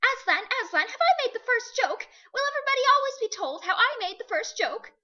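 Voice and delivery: high, loud and eager bird voice; a small bird bursting with pride; excited jackdaw. A narrator's reading. The jackdaw calling eagerly to Aslan, high and loud.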